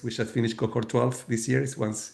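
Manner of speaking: ordinary talk, nothing marked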